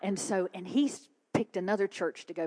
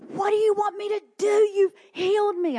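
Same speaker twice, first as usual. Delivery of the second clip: high, loud, fast protest; worked-up retold plea; exasperated; 13 semitones higher, 8 dB louder than usual. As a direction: cry it out to heaven, pitched way up. Exasperated cry to God, retold.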